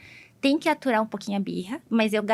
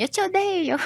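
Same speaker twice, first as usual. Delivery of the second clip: high mock child's "I hate you"; pouty kid voice, hammed-up; imitating a sulky child; 10 semitones higher than usual. Child quoted saying hates her.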